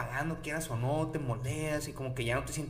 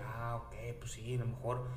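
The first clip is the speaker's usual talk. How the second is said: duda interna en voz baja; 6 semitones lower, 6 dB quieter than usual. Retold or imitated.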